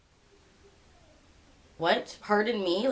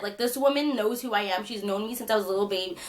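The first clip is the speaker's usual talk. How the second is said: normal talking voice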